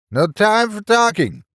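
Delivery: high sharp falsetto villain. Acted. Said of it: "he said, sharply".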